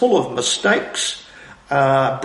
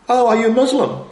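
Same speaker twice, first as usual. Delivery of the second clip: a light, higher mock-innocent question; 7 semitones higher than usual. Hanson asking the senator, mock-innocent. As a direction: ask it all innocence, as if you truly don't know. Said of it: "in a very sort of innocent tone".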